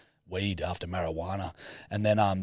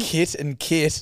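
New Zealand accent; 9 semitones higher, 6 dB louder, much slower than usual. Shown as a demonstration. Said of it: "with the New Zealand accent".

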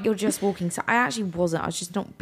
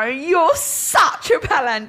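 higher, louder, slow mock yell; retold yell, pitched up and drawn out; 7 semitones higher, 4 dB louder, much slower than usual. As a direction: shout it to embarrass him. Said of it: "I screamed loud".

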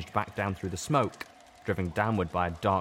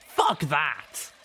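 higher, sharp and defiant; defiant retort; 8 semitones higher, 4 dB louder than usual. A narrator's reading. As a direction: spit it back at him. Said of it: "The fiery-maned mare retorted".

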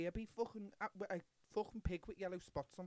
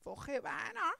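Scouse accent; 13 semitones higher, 6 dB louder, slower than usual. Shown as a demonstration. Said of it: "Could you do it in a Scouse accent?".

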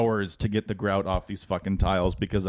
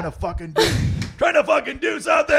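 screaming mock rage, much louder and higher; furious yell; 9 dB louder than usual. Dad yelling at kids interrupting his tiling, furious. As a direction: blow up, hammed-up fury at the kids. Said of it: "I'd be like".

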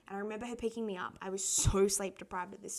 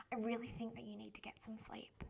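whispered advice; 8 dB quieter than usual. Retold or imitated. Therapist telling sleepy teen.

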